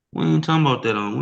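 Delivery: ordinary talk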